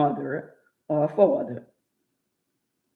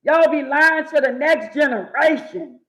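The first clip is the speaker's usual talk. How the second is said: building retold rant; 8 semitones higher, 6 dB louder than usual. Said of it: "And I be like".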